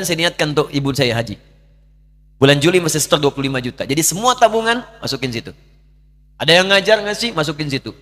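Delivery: preaching at full force. Acted. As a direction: build the story to its peak. Preacher climaxes the story.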